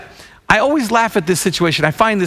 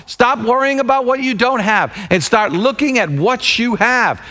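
fiery preaching; 6 semitones higher than usual. Acted. Preacher urging the congregation to get up, rousing. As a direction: rouse the church to action.

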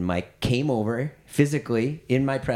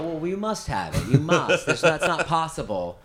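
insisting disbelief; 5 semitones higher, 3 dB louder than usual. Retold or imitated. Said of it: "And I go".

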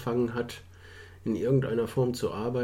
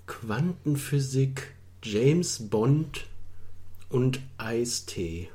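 slow puzzled; 3 dB quieter, much slower than usual. Real, not acted.

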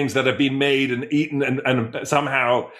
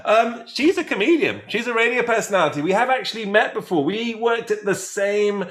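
upbeat, raised host announcement voice; show host intro; 7 semitones higher than usual. Acted. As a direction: welcome the audience like a radio host.

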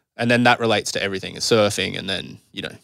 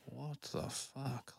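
very soft, drawn-out bewilderment; hushed, stretched retold disbelief; bewildered; 4 semitones higher, 22 dB quieter, much slower than usual. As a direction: let it out slowly under the breath. Acts out bewildered reaction leaving session.

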